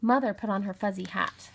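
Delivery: usual speaking voice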